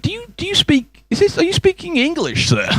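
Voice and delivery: high-pitched, incredulous mock questioning; baffled American. American man asks the comic if that is English, high mock bafflement. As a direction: imitate a bewildered tourist, voice pushed way up.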